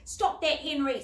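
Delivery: loud, firm rebuke; stern. A narrator's reading. Older boy sternly tells Henry to stop scaring them. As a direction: shut the joke down firmly. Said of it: "said Michael sternly".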